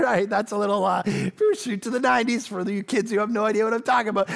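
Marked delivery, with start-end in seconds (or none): none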